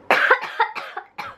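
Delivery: hoarse high cough; a sick coughing fit. Real, not acted.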